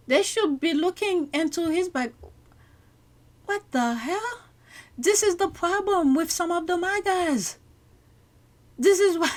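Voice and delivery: high, loud outraged outburst. Real, not acted. Commentator blasting the man who threatened a wife, outraged and loud. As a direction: let the outrage spill over.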